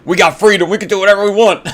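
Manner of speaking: loud, high gung-ho American parody; hammed-up boast, high and loud; mock patriot. American sending up his country, mock patriotic shout.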